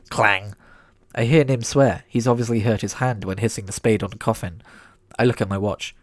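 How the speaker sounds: plain reading voice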